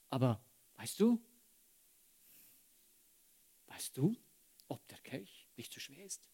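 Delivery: hushed, whispered doubt from Satan; soft insidious whisper of the tempter; tempting whisper. Acted. Satan tempting Christus in Gethsemane.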